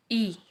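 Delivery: "ई" said as a long ee; German letter I. Shown as a demonstration. Teacher sounds the letter "ई" for students. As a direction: hold "ई" as German I, ee.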